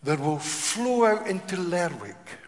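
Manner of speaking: preacher building to a climax; building declaration. Real, not acted.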